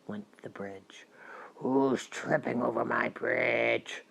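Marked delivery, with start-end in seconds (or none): 1.5-4.0 low, slow troll growl, held back in volume; roaring troll. A narrator's reading. Troll challenges the youngest goat on the bridge, a slow low roar. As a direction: growl slowly like a lurking troll. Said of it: "roared the troll".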